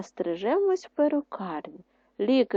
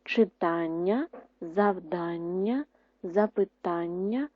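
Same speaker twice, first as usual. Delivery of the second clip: Читання, завдання, запитання read slowly, each syllable clear; slow dictation; slower than usual. Shown as a demonstration. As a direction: dictate these words slowly. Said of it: "записали слова". (повільний диктант)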